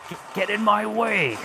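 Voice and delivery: raging outburst. Retold or imitated. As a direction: snap out at the game. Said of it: "this is not me, obviously".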